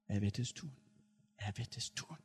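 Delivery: softly, with a catch of tears; retold tearful promise, faint; a hint of weeping; through tears. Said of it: "unter Tränen".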